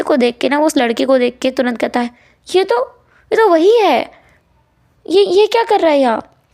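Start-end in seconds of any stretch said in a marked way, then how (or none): 2.5-6.4 much higher and louder, startled; shocked recognition. Acted. Wing spots the other boy beside Huesang, shocked and loud. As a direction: blurt it out in disbelief.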